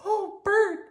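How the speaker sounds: put-on very high little-girl voice; tiny puppet girl, calling; Prairie Dawn. A narrator's reading. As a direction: call out in a high falsetto. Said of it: "said Prairie Dawn".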